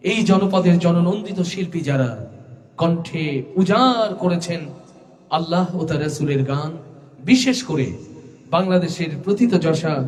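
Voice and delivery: ordinary talk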